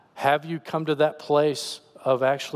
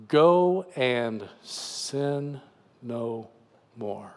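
slow, deliberate quote; much slower than usual. Acted. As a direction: weigh each word, letting it sink away. Quoting the recurring phrase slowly.

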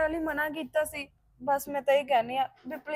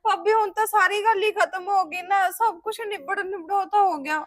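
higher, louder protesting plea; exasperated protest; 7 semitones higher, 6 dB louder than usual. Acted. Sister protesting to her sister-in-law, exasperated and loud. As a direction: push back, fed up with the nagging.

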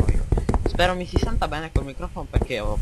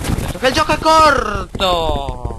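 louder, higher, slow insistent shout; 7 semitones higher, 9 dB louder, slower than usual. Acted. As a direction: bellow it with mock insistence. Repeats line loudly then plainly.

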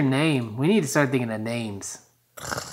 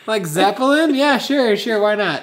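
mock careless agreement; 6 semitones higher, 5 dB louder than usual. Mocking careless naming.

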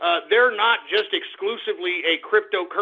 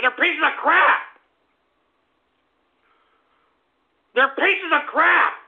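angry rant; 7 semitones higher than usual. Acted. Host ranting.

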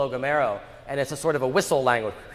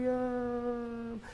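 long stretched vowels, much higher and softer; drawn out vowels; 9 semitones higher, 8 dB quieter than usual. Shown as a demonstration. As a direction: stretch each vowel like calling someone far off. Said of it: "You sort of stretch the vowels out".

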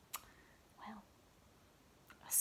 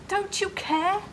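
high and loud, pleading; desperate plea; 6 semitones higher, 10 dB louder than usual. A narrator's reading. Disciples shouting at sleeping Jesus, desperate. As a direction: shout the accusation in panic.

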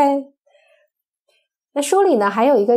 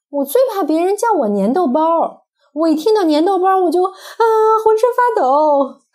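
higher, louder hammed-up dread, slowing at the end; mock fear; 8 semitones higher, 3 dB louder than usual. Mom playing scared of a nickname for the child, mock terror. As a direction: pretend to tremble over a silly name.

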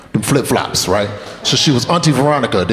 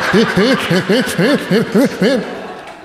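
high hiccuping fake sobs; hammed-up stuttering whimpers; mock child sobbing; 8 semitones higher than usual. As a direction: imitate a whooped kid's jerky sobbing. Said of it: "your bottom lip start tugging".

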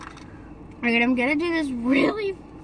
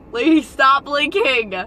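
loud, slow, drawn-out shout; exasperated yell; 10 dB louder, slower than usual. Real, not acted. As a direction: yell at her to stop blinking. Frustrated makeup session, yelling.

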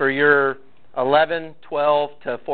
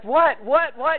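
mock alarm; 10 semitones higher, slower than usual. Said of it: "I am also like".

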